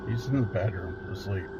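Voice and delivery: ordinary delivery, nothing marked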